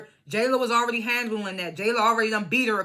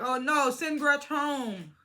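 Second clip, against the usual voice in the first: mock dismay; 3 semitones higher, 4 dB quieter, much slower than usual. Mimicking a bystander calling out from the background, mock dismay. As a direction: drag it out, pitched up, mock-alarmed. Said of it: "You was in the background like".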